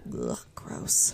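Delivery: whispered disgusted ugh; annoyed ugh. Real, not acted. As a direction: breathe it out in disgust. Reacting to creepy villain.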